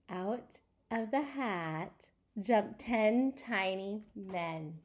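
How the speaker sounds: slow, drawn-out suspense; dramatic slow reveal. Shown as a demonstration. Storyteller teasing the reveal to children, slowly. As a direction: stretch out the big reveal. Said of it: "Do you see the pictures".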